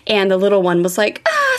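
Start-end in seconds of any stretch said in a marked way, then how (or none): 1.3-1.6 high-pitched imitated child's scream at normal volume; mock toddler scream. Toddler whose toes got stepped on, mock scream. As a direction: imitate a little one's sudden yelp. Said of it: "started screaming".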